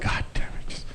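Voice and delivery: unvoiced muttered curse; whisper. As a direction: breathe the curse under your breath. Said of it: "He was like".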